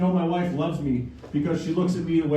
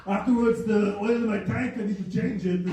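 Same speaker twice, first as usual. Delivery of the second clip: lightly higher Austrian Arnold voice; Arnold accent; 4 semitones higher than usual. Retold or imitated. Arnold turning down the comedy show, light Austrian accent. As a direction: brush off with a mild Austrian lilt. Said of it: "He's like".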